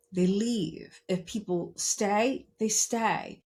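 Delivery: normal talking voice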